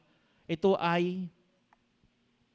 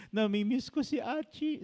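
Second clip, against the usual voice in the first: higher whiny mock of the wife; imitating crying wife; 7 semitones higher than usual. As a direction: lightly mimic the wife's tearful complaint. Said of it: "umiiyak siya". (ginagayang umiiyak na asawa)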